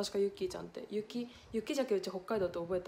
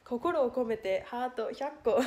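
ordinary talk

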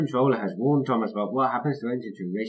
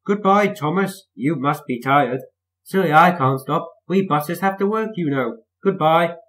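taunting; 6 semitones higher, 5 dB louder than usual. A narrator's reading. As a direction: gloat as you pass. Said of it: "Bertie taunts passing Thomas".

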